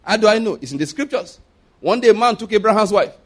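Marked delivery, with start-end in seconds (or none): none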